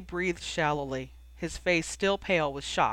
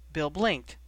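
usual speaking voice

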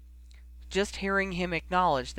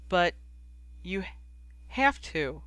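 one word at a time through tears; choked-up, broken delivery; 2 semitones higher than usual. A narrator's reading. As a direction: gasp out a halting plea. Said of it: "she choked".

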